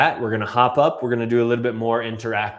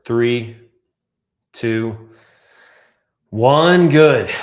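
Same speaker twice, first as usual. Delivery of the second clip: loud countdown finish; 9 dB louder, much slower than usual. Shown as a demonstration. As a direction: call the last count out loud. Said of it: "countdown ending a breath hold".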